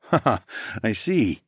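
light chuckle into the words; chuckling. A narrator's reading. Traveler amused by the younger brother, chuckling. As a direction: give a short amused laugh, then speak. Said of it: "I chuckled".

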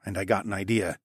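ordinary delivery

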